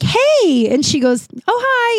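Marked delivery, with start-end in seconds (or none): 0.0-0.7 high-pitched friendly hey; cheery greeting. Retold or imitated. Greeting the bartender on arriving sober, high and friendly. 1.5-2.0 imitated high-pitched sweet greeting; nice, friendly. Bartender greeting a regular, high and nice. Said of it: "she's always so nice".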